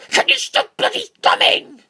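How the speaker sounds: angry teacher. Retold or imitated. Teacher scolding him for humming again, angry mock yell. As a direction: snap it like a furious teacher. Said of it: "told me out of very angry voice".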